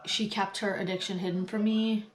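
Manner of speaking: normal talking voice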